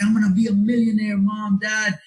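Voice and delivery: fired up. Retold or imitated. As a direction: announce it to your parents like you've struck gold. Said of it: "all fucking fired up".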